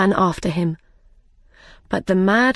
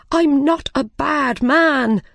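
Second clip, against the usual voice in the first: high, slower pleading cry; 7 semitones higher, slower than usual. A narrator's reading. The frightened Hatter pleads with the king, crying out. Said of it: "the Mad Hatter cried".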